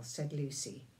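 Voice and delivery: plain reading voice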